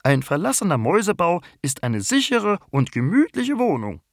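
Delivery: very high bumblebee voice, a little sleepy; the queen bumblebee introduces herself; young queen bumblebee. Acted. The bumblebee keeps explaining. (Hummelkönigin mit sehr hoher Stimme, etwas verschlafen)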